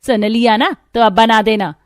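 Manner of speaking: loud, high-pitched command; bossy order. Acted. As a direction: lay down the law to your daughter-in-law. Mother-in-law orders her. (ज़ोर से हुक्म देती सास)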